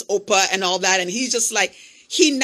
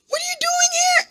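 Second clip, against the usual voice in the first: overjoyed shout. Retold or imitated. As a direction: shriek it with happy tears. Friend's joyful surprise, hugging.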